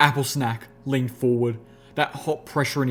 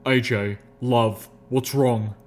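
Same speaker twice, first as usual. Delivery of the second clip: more firmly; slower than usual. A narrator's reading. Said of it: "Again, more firmly".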